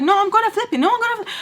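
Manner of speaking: very high, insistent retort; squeaky stubborn comeback, acted out; mock defiance. Acted quarrel, own defiant reply.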